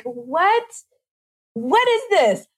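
high, baffled what is this; the body reacting to a strange chemical, mock shocked, high. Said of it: "Our body's like".

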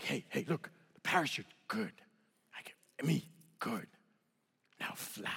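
soft, low, halting phrases; hushed instructor voice, fading to nearly nothing; gentle broken-English reassurance. Retold or imitated. Instructor tapping the jumper to reassure him, hushed. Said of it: "limited lexicon that I have when it comes to English".

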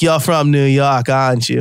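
imitating a Boston local sizing up a stranger; Boston accent. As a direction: put on Boston vowels and dropped R's. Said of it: "this is very stereotypically Boston".

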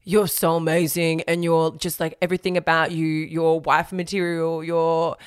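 a hint of Felix sweet-talking before the letdown; imitating Felix. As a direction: play him gushing to Abigail, voice only slightly changed. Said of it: "I was gonna do her accent".

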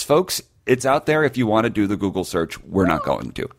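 usual speaking voice

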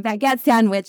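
normal talking voice

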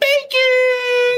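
high-pitched, drawn-out holler of the code; big cartoonish announcer yell; hyped promo shout. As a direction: belt it out like a showman's catchphrase.

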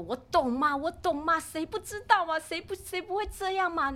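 high, loud insisting I understand; pushing the kids with a know-it-all whine; insistent old self. Retold or imitated. Mother insisting to her kids, high and loud. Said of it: "以前我都一直说".

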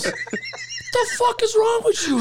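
very high, slow, outraged question; drawn-out, high-pitched mock outrage; retold appalled scolding. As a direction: mimic a horrified coworker telling someone off. Coworkers scold him.

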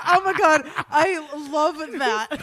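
laughing loudly, stretching out I love that; delighted laugh. Real, not acted. Friend cracking up at the interviewer story, laughing loud. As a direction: giggle it out, thrilled by the gaffe.

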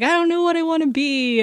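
high, lost and whining; whiny lost voice. Retold or imitated. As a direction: moan like a confused twentysomething. Quoting lost friends in twenties.

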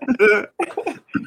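high, wheezy laugh at own joke; high cackle. Real, not acted. Laughing at his own fake taxi joke, high cackle. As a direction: crack yourself up. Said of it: "cracked myself up".

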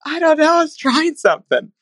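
high-pitched imitation of a helpless protest; retold crying-laughing. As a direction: mimic a colleague squeaking through tearful laughter. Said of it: "we were crying, laughing, going".

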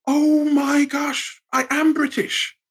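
high, slow dawning realisation. Retold or imitated. Speaker recalling realising he is British at the Olympics. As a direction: let it dawn on you slowly.

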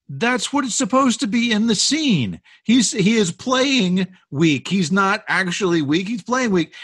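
high-pitched, insistent mock rebuttal; exasperated retort. Producer arguing back in retold quarrel.

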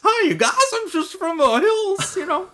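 squeaky high hillbilly cartoon; Mickey Mouse voice. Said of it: "nineteen thirties Mickey Mouse cartoonish".

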